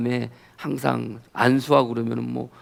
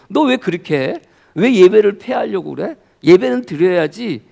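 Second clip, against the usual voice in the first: louder, higher mock scolding; retold reproach; 5 semitones higher, 7 dB louder than usual. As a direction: voice a divine rebuke through a friend. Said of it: "저를 책망하시는 말씀 같았어요".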